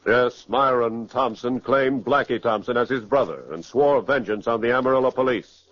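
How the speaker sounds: usual speaking voice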